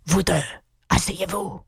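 creaky beast giving orders; commanding lion. A narrator's reading. Lion ordering king and unicorn, commanding. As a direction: order them down in a put-on lion voice. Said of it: "ordonna-t-il au roi et à la licorne".

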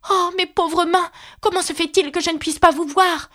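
dismayed Alice. Acted. Alice dismayed at lost hands.

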